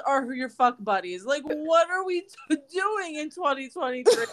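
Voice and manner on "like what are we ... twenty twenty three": normal talking voice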